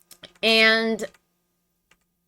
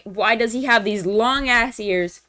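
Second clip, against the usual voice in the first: ordinary talk, nothing marked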